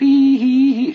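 crazy high-pitched giggle; high cackle. Acted. Teddy crowing at his friends, high cackle. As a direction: cackle like a lunatic.

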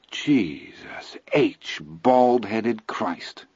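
very slow awed whisper; whispered curse. A narrator's reading. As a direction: breathe it out in shock. Spooked after the scream.

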